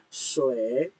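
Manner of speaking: "Sui" with a dipping third tone, down then up; nada ketiga. Shown as a demonstration. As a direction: say "Sui" low, then let it rise. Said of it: "nada tiga ya". ("Sui" nada tiga, turun lalu naik)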